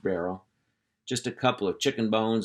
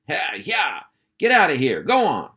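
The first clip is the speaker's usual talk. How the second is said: loud, higher shout to chase them off; angry yelling man; 5 semitones higher, 6 dB louder than usual. Acted. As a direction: yell at the kids to scram. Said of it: "yelling and waving his arms".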